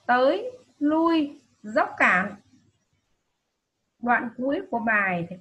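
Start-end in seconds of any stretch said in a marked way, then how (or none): 0.1-1.4 tới and lui slow, higher and louder; emphasised words. Shown as a demonstration. Teacher showing pupils which words to stress, slow and loud. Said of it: "cần nhấn giọng". 1.6-2.3 dốc cạn louder, dốc pressed down; emphasised words. Shown as a demonstration. Teacher showing pupils which words to stress, loud. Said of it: "cần nhấn giọng".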